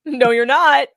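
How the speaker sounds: loud, firm no; mock stern refusal. Mocking the judge, a loud flat refusal. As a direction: shut her down like the judge.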